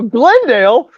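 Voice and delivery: high, loud incredulous outburst; retold astonishment at a hero; blown away. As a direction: question it in total disbelief, voice shooting up. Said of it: "I was blown away".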